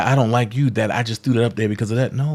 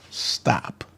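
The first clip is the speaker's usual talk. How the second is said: whispered stop; 5 semitones lower, 11 dB quieter than usual. Real, not acted. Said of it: "cuts off the reading".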